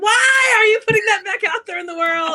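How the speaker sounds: high, loud, half-crying wail; mock outraged cry. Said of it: "And I was like".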